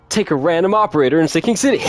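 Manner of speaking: high, loud, laughing exclamation; real amusement pushing the voice up; amused disbelief. Real, not acted. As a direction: blurt it out loud between laughs. Amused at a viewer's absurd request.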